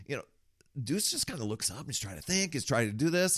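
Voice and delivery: ordinary delivery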